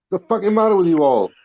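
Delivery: ordinary delivery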